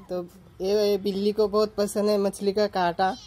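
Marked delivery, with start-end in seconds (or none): none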